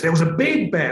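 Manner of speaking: big bear voice. Shown as a demonstration. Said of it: "read a bedtime story".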